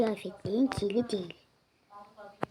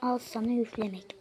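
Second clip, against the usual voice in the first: normal talking voice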